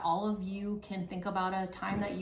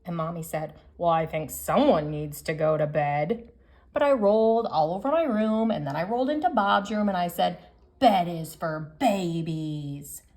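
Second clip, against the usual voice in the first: lively picture-book read-aloud, louder than her chat; reading to children; 6 dB louder than usual. Acted. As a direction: read it out to the class like story time. Teacher reads picture book to class.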